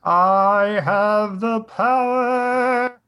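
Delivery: high, loud, slow heroic cry; bright magical girl. Retold or imitated. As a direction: belt it out high and slow like a hero transforming. He-Man's transformation cry quoted.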